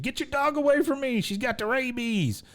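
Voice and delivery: very high, strained cry for help; mock panicked yell. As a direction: imitate a panicked man stuck up a tree. Dale treed by a growling dog.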